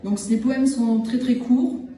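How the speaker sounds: usual speaking voice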